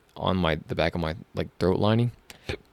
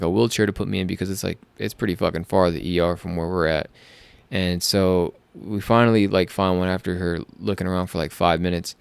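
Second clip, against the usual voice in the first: ordinary delivery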